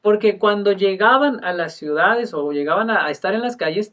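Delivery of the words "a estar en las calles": ordinary delivery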